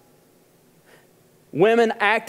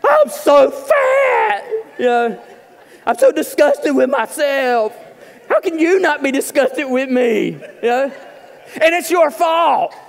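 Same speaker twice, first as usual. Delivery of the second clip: hammed-up whiny wail, much higher and louder; husband at the mirror, over the top and mock-tearful; mock sobbing husband; 6 semitones higher, 3 dB louder than usual. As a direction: laugh through a fake weepy falsetto complaint. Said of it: "just sobbing".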